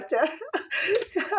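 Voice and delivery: high burst of laughter; amused laughter mid-request; real laugh. Real, not acted. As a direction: break into a high giggle. Laughs while asking for a like.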